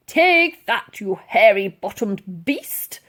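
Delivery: loud blustering colonel, slow on the insult; furious Colonel whacking the beast with his cane; angry old colonel. Acted. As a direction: bellow it as you strike. Old Colonel striking the mammoth.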